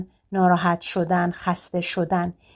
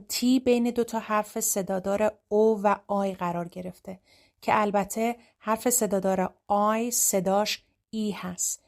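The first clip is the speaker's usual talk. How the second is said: ordinary delivery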